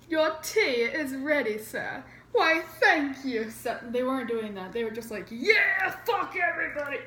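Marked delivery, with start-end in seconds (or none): 0.1-3.8 mock British accent. Colonists pretending to take tea politely, in mock British accent. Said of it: "I was trying to do a British accent". 5.3-7.0 high, loud hammed-up rebel cheer; mock rowdy yell. Rebels dumping tea at the king, mock defiant yell.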